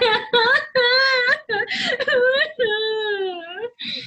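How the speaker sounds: high squeaky cry; baby bear wail. A narrator's reading. Baby Bear starts crying, high wail. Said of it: "Baby Bear began to cry".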